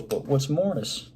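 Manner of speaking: ordinary delivery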